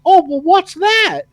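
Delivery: high, chirpy little question; curious cricket. Retold or imitated. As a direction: play the naive cricket asking. Cricket asks Horner.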